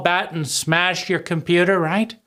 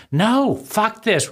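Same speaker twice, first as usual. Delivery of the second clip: drawn-out, higher "fuck this"; slow defiant retort; 7 semitones higher, slower than usual. Retold or imitated. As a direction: say it slowly, fed up and defiant. Defiant retort to inner critic.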